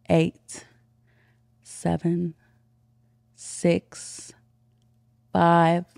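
loud, slow dominant countdown; commanding countdown. Shown as a demonstration. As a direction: count down like you're in charge. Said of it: "do the countdown part".

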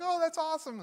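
high-pitched imitation of a delighted wife; acted delight, pitched well up. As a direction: coo it like a pleased wife. Wife excited by the elf, retold.

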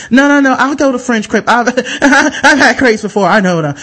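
hammed-up know-it-all insistence; insistent customer.

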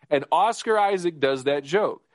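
usual speaking voice

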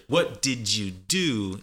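"What did you do?" slowly as "what did-joo do"; slow "What did you do" with a J sound; careful form, still linked. Shown as a demonstration. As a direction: stretch the words, keep the J in "did you". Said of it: "It still has it there".

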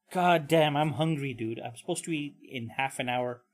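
usual speaking voice

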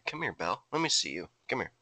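low, soft murmur to a pet; soft coaxing. Real, not acted. Host to the cat rubbing his leg, soft coaxing. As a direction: call the cat over quietly.

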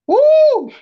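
loud high excited whoop. Real, not acted. Woman recalling confessing to a guy, loud whoop. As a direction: let out a big whoop.

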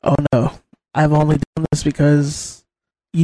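a sultry after-dark host greeting; late-night stream voice. Shown as a demonstration. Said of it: "I start talking like this".

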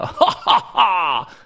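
high cackling villain laugh; evil scientist laugh. Pretend. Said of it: "That was my evil scientist laugh".

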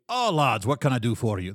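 put-on hearty dwarf shopkeeper in a Russian-Scottish accent; Haggerty's Russian Scottish accent. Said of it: "Haggerty's is usually".

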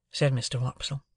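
ordinary delivery

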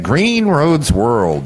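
slow, high, stretched-out sponsor name; drawn-out announcer voice. Shown as a demonstration. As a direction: savor every word like a radio ad. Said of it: "I know I like to say it".

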